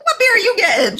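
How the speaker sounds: high mock scornful girl; high disdainful voice. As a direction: sneer it in a high voice.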